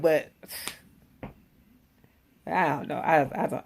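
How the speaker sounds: normal talking voice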